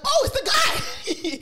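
high, drawn-out excited cry; excited recognition. Retold or imitated. Friend spots the guy who gets them in, excited cry. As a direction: call it out high and thrilled. Retelling her relief at spotting him.

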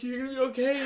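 high, loud, frantic father impression; panicked dad, imitated. Dad driving injured son to hospital, panicked. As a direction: pitch it way up, playing an alarmed parent.

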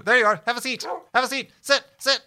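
loud, high command to a dog; calling to the dog. Real, not acted. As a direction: call the dog sharply.

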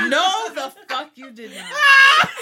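slow, loud laughing disbelief; laughing shout. Real, not acted. Friend hears she played the song, shouts laughing. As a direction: scream it out cracking up in disbelief.

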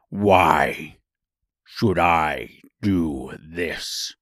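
low, very slow monster voice; demanding beast. A narrator's reading. Beast challenging the woodman, slow deep demand. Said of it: "demanded the beast".

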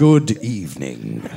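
very low, rich radio-host voice; Frasier. Acted. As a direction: drop deep and plummy like a sitcom shrink.

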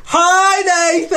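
squealed high greeting; shrill cartoonish hello; silly high voice. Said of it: "I don't know if she meant it in that, in that voice".

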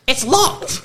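high-pitched imitated call; mock indignant lady. Woman outside the locked toilet door, mock indignant high call. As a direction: call it out high, half laughing.

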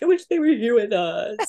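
a touch of retold crying, wistful; mild mock tearful wish. Telling her friend about crying on vacation, lightly tearful. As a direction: sigh it out, missing the kids. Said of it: "the whole time I was crying".

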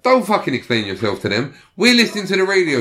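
rising to a high, loud insistence; imitating lunatic mate. Lunatic mate overruling everyone on the bus, mock outrage. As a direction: act out a wound-up friend laying down the law. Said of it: "he's a lunatic".

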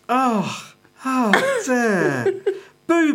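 high, laughing read through nausea; disgusted laughter. Real, not acted. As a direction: read it while laughing and grossed out. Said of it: "You're just gonna be sick".

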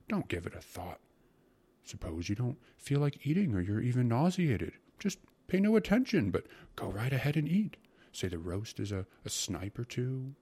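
effusive food-lover with swooping pitch; lively, swooping character voice; coaxing secretary. Acted. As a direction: savor each dish to tempt the listener.

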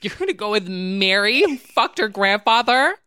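high, loud outraged shout; shrill accusing yell. Retold or imitated. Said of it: "when she's like".

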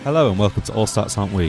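ordinary talk

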